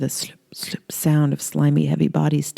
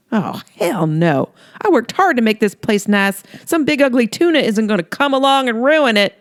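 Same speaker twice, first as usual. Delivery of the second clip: rising higher, louder indignant anger; fed-up fury; 7 semitones higher, 3 dB louder than usual. Acted. Holly defending her porch from the monster, building anger.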